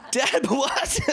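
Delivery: high incredulous yelp; surprised. Retold or imitated. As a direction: caught off guard by the word. Girlfriend calls him dad body.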